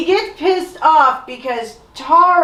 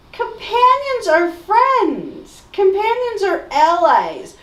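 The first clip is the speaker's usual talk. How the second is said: indignant insistence; 9 semitones higher than usual. Real, not acted. Defending the word companion.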